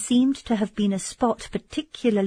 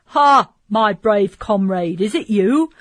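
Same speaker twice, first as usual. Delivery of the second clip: put-on louder, slowed hail to an old friend; joyful shout; 3 semitones higher, 7 dB louder than usual. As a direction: greet a comrade across the dark with delight. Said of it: "uttered a shout of joy".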